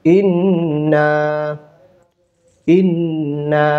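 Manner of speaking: ইন্না said slowly, final vowel held long; long madd. Shown as a demonstration. Teacher drilling learners on pronunciation, slow drawn-out ইন্না. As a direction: stretch both the nasal "nn" and the closing "aa" of ইন্না. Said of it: "উচ্চারণ করি".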